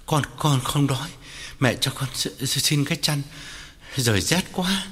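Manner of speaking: halting, stammered words from a shivering body; shivering with fever. A narrator's reading. Feverish son asking his mother for a blanket, shivering. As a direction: teeth chattering, can barely get it out. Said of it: "Tân run run đáp".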